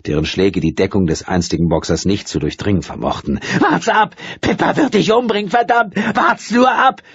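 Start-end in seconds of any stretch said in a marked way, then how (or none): 3.6-7.0 high shrieking threat. A narrator's reading. Furious woman threatening the man with Pippa, shrieking. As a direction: hurl the threat at him, shrieking. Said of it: "der kreischenden Frau".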